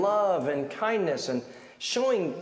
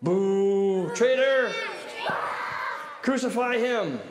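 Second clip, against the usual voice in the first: raised mock-angry boos and calls; angry jeering; 4 semitones higher, slower than usual. Shown as a demonstration. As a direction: play the crowd turning on him. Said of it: "into angry jeers and insults".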